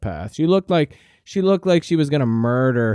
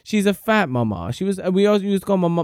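ordinary talk, nothing marked

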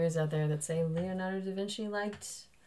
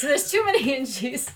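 said through laughter, higher; laughing repeat; 6 semitones higher, 5 dB louder than usual. Retold or imitated. Friend repeating the joke again, laughing.

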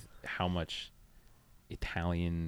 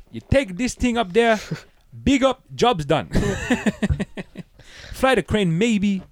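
high, loud fake Jamaican; hammy Chet Hanks patois; Jamaican accent; 12 semitones higher, 7 dB louder, slower than usual. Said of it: "Chet shows up".

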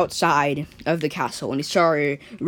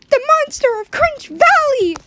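alarmed cry; 6 dB louder than usual. Acted. Family cries out in alarm.

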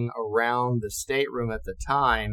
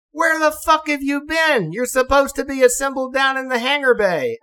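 higher, louder furious scolding; angry officers; 12 semitones higher, 5 dB louder than usual. Retold or imitated. Senior officers scolding a missing ensign, angry yell. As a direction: chew him out.

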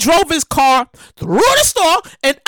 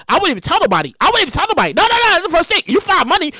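ordinary talk, nothing marked

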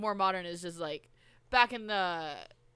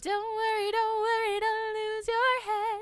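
much higher mock sweet voice; silly high voice; 13 semitones higher, slower than usual. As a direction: play a cartoonish reassuring character. Joking voice about the beheading.

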